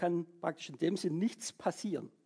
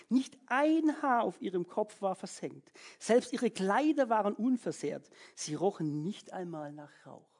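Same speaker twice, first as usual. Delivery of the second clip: ordinary talk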